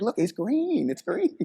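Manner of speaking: high-pitched imitation of disgusted protest; mock squeamish protest. Assistant balking at the crab's green guts, mock high protest.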